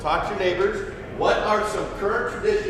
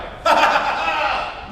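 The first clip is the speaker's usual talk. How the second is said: mocking laugh; 11 semitones higher, 3 dB louder than usual. Mocking freshmen during hazing.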